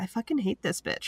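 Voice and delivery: hushed hateful mutter. Retold or imitated. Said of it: "I'm like".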